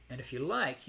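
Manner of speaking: ordinary talk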